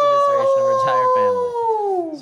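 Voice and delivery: high, loud shrieking read; dramatic shriek. Acted. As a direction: shriek the line in mock horror. Reading shocking line aloud.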